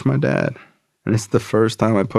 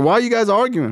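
much higher put-on voice of a blunt sponsor; tough sponsor voice; 13 semitones higher, faster than usual. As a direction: bark out the tough-love lecture.

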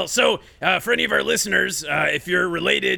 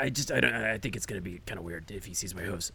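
low hushed aside; secretive whisper; 10 semitones lower, 11 dB quieter than usual. Acted. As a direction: keep it hidden from Genghis.

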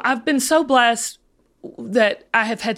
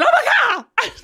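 high, stunned oh my God; a jump up in pitch, surprised; shocked gasp; 12 semitones higher than usual. Retold or imitated. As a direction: retell the moment it hit you. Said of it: "and I said".

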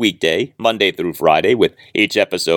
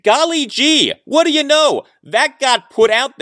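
mock surprise. Real, not acted. Host mocking the timing of the report release, sarcastically high and amazed. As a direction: feign innocent amazement, dripping irony.

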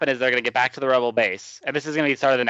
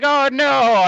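high, loud cartoon "oh no"; hammed-up alarm; mock dismay; 13 semitones higher, 5 dB louder than usual. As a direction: yelp it as if you just spilled it. Saw knocks barrel over.

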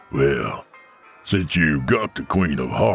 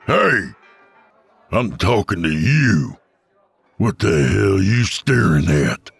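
angry gunman; 3 semitones higher than usual. Acted. Gunman barking at staring Tony, angry. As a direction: demand an answer, threatening.

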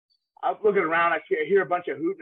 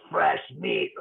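much lower, predatory line; mock-scary deep fresh meat; menacing greeting; 14 semitones lower than usual. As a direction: growl it like sizing up prey. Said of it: "looks at me and goes".

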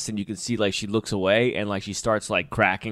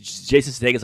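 ordinary delivery

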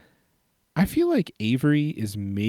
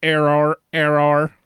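robot voice; 4 semitones higher, 6 dB louder than usual. Pretend. Robot malfunction after fumble.

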